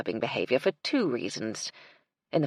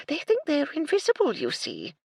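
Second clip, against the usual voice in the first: put-on dotty old lady; 11 semitones higher than usual. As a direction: coo it over imaginary birds. Widow confides about birds.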